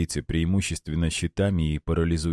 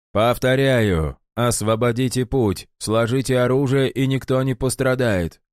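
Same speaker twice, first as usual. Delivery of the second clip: weary, calm repeated order; tired but unshaken; 6 semitones higher, 3 dB louder than usual. A narrator's reading. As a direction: repeat it wearily, unmoved. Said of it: "Голос сержанта звучал устало, но невозмутимо".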